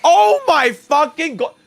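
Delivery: high, loud disbelieving yell; real excited shout. Real, not acted. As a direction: blurt it out in shock. Flood of gift subs.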